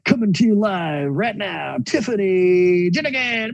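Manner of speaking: booming ringmaster introduction, rising high; grand entrance announcement. Pretend. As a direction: announce her like a circus star. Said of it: "Do you need me to introduce anything else?".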